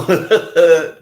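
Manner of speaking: loud, delighted laugh; burst of laughter. Real, not acted. Streamer cracks up as his mic light dies, laughing.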